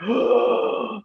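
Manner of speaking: long mock gasp; shocked gasp. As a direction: suck in air, imitating a shocked kid. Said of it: "like, shocked".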